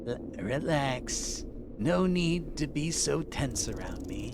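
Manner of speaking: snake voice. Acted. Prisoner telling the stranger to relax, snake voice. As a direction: soothe them like a sly serpent. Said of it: "I can't keep doing this voice".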